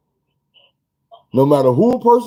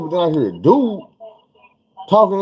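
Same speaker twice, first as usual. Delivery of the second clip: normal talking voice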